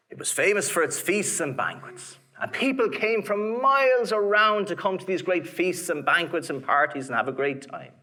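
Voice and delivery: ordinary delivery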